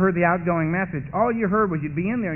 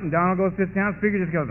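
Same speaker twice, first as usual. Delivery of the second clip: normal talking voice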